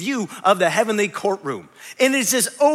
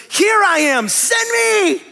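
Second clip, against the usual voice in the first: much higher, louder eager shout; eager volunteer; 10 semitones higher, 5 dB louder, much slower than usual. Acted. Isaiah answering God's call, shouting eagerly to be sent. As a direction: leap up and volunteer with everything. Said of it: "he goes".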